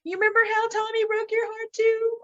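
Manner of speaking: high, far put-on voice of a sad friend; mock sad friend. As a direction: squeak it out like a mopey friend.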